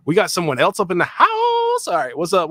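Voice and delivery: loud, high and happy hello; excited greeting. Real, not acted. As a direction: greet the new guest with a big cheer. New guest joins the stream.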